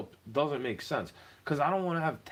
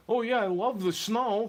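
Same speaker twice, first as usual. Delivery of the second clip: mocking quote; 8 semitones higher than usual. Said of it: "everything's dandy".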